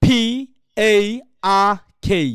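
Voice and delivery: loud, separated English letters, "আর" as "ah"; native-style spelling. Shown as a demonstration. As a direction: spell it out with spaces, puffed "পি", open "আর" without R. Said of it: "ওরা বলবে কি আ".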